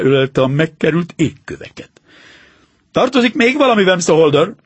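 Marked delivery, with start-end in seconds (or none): 2.9-4.5 rather sternly. Acted. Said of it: "meglehetősen szigorúan".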